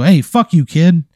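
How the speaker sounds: loud, high angry shout; mad outburst. Acted. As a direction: lash out at a heckling child. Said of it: "you get so mad".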